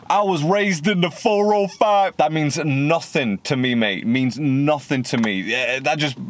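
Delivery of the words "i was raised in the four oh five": high, sneering parrot of the line; mocking imitation. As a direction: mimic the rapper with scorn, pitched up. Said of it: "I hate that shit".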